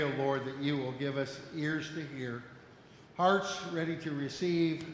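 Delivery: hushed, reverent prayer voice. Acted. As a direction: pray softly and humbly. Opening prayer.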